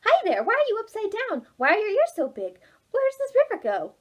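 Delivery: high, eager questions; curious young Oliver. Acted. Oliver asking the new animals, high and eager. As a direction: fire off questions like a curious kid.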